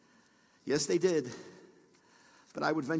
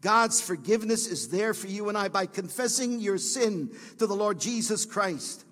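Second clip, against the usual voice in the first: ordinary talk, nothing marked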